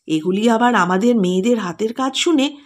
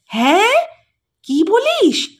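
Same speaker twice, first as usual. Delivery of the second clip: "হ্যাঁ" rising high in amazement; can't believe the good news; higher, thrilled disbelief; delighted surprise; 8 semitones higher, slower than usual. A narrator's reading. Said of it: "বিস্ময়ে আনন্দে উচ্চারণ করে".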